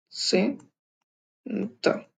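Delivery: Centre stretched very slowly, soft; very drawn out. Shown as a demonstration. Slowed way down so students catch centre. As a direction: draw centre out syllable by syllable. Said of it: "इस तरह से pronounce होगा".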